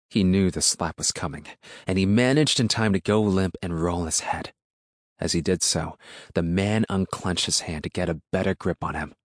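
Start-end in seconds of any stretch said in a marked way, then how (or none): none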